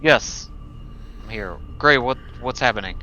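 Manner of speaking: usual speaking voice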